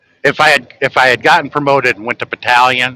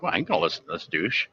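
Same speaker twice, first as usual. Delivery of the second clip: muttered scorn; 6 semitones lower, 14 dB quieter, faster than usual. Retold or imitated. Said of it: "They're gonna be like".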